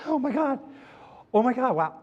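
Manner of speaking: high, bright-eyed gasping delight; overjoyed at a flat tire, hammed up; mock delight. Husband thrilled about the flat tire, mock excited.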